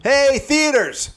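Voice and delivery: high, drawn-out holler; mock hailing call. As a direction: hail them slowly with mock gusto. Joke greeting by comedy rank, calling out.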